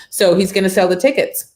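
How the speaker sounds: normal talking voice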